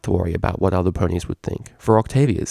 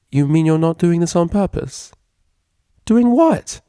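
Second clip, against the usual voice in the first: higher, puzzled question; bewildered; 9 semitones higher, 3 dB louder than usual. Acted. Vinyl bewildered by her roommate's odd behaviour, high and puzzled. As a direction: ask it baffled and high. Confused exchange between roommates.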